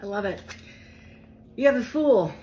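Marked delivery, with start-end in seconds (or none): 1.5-2.3 excited reveal. Real, not acted.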